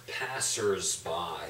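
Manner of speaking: "Passers-by" drawn out and low; slow careful word. Shown as a demonstration. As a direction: say "Passers-by" slowly, each syllable. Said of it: "another word we never use".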